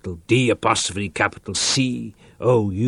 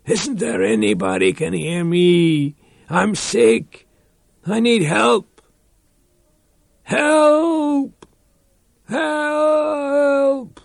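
rising to a high, crying shout for help; desperate shout; 14 semitones higher, 4 dB louder, slower than usual. Acted. Reich alone in the dark, shouting for anyone to help. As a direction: build from pleading to a high cry of help. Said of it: "Again he shouted".

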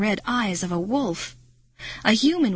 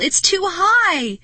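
very high, drawn-out loud wail; long, helpless high cry; 11 semitones higher, 5 dB louder, slower than usual. A narrator's reading. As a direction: wail it slowly in despair. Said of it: "he wailed".

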